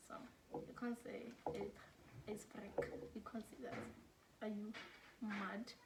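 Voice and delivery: mock reproach kept under the breath; hushed mock scolding. Teacher joking to students about what can't be said, hushed. Acting out softening words for euphemism.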